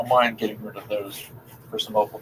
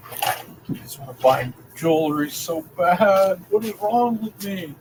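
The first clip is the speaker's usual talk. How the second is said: whiny; 8 semitones higher, 4 dB louder, slower than usual. Real, not acted.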